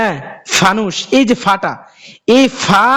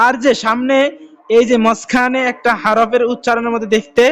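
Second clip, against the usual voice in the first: usual speaking voice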